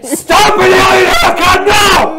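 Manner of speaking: high, loud hammed-up yell; over-the-top wrestler-style shouting; extra pizzazz. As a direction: scream the comment with showboating pizzazz. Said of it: "give, uh, Ghost's comment a little more".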